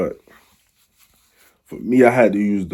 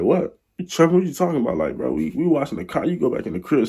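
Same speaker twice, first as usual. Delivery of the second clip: higher, incredulous retort to the owner; mock disbelief; 7 semitones higher than usual. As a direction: act shocked, like he's tripping.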